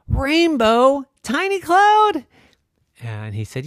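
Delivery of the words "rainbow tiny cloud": very high, loud disbelieving cry; astonished. A narrator's reading. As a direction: gasp out the name in shock. Clouds amazed.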